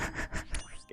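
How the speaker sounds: brief breathy, soft laugh; quietly amused little laugh; soft chuckle. Real, not acted. Amused by own new title.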